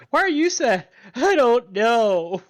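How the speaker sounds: high, drawn-out comic crying voice; mock tearful wail. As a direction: wail it like a hammed-up sympathy cry. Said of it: "Guy is crying because Flaw is crying".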